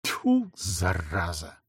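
тихое досадное тьфу, медленно; сплюнь и выругайся с досадой себе под нос; сплюнул в отчаянии. Acted. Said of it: "сплюнул на пол отчаявшийся". (Егор один, сплёвывает в отчаянии)

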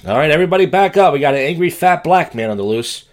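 loud, fast, high mock alarm; mock crowd warning. Host warning viewers about the angry man, mock alarm. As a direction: clear the area like a joking cop.

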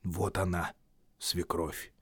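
inner thought. A narrator's reading. The boy thinks silently about the mother-in-law, low and quiet. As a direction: think it to yourself. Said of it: "подумал я".

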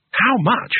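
a voice jumping up with urgency; urgent. A narrator's reading. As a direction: blurt it out eagerly. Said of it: "urgently reaching into his pocket".

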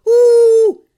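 loud high drawn-out boo; crowd booing. A narrator's reading. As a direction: boo hard at the impostors.

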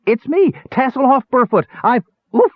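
high, excited shout cut by an oof; overjoyed call. A narrator's reading. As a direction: hail rescuers eagerly until the breath is knocked out. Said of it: "nearly hysterical with joy".

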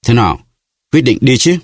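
impatient prompt rising in pitch; urging. A narrator's reading. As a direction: press them to decide, voice climbing. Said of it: "Duy Sắc lại giục".

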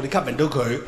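ordinary delivery

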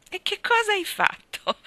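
very high, loud astonished question; alarmed question. A narrator's reading. Anna hearing Davy has been bad, alarmed question. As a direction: shoot way up in pitch, aghast.

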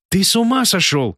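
high, urgent protest; alarmed. Acted. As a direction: cry out in disbelief. Anka alarmed at Artur.

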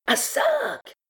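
very high, drawn-out cry; mob battle cry. A narrator's reading. Beggars repeating the call to pillage, crying out. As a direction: cry out like a crowd of looters. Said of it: "répétaient les argotiers".

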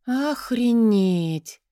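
slow, drawn-out shocked curse; shocked outburst. Acted. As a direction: let the curse burst out. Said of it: "Вырывается совсем не учительское".

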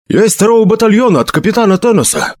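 high, hurried messenger's report; rapid patter. A narrator's reading. Messenger reports to Felix from another battalion, gabbling. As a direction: rattle it off urgently. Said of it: "скороговоркой заговорил посланник".

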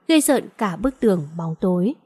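ordinary delivery

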